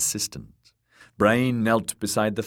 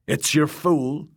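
slower, higher character voice; Crab's voice; 8 semitones higher than usual. A narrator's reading. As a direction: tell her who it is. Crab announcing the fool.

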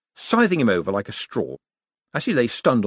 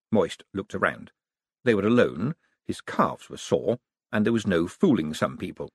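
usual speaking voice

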